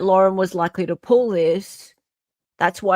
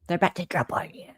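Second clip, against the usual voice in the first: spooky whisper; 3 semitones lower, 8 dB quieter, faster than usual. Pretend. Spooky drop bear joke.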